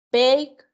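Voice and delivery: Bake said as one clean syllable, no added it sound; pronunciation demo. Shown as a demonstration. Teacher modelling Bake for students, one clean syllable. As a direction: model Bake for the class, ending crisp on k. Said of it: "¿Se fijan? No es bake it".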